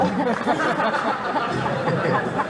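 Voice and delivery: high laughing burst; laughter. Real, not acted. Host laughs at his own Brooklyn accent joke, high laughter.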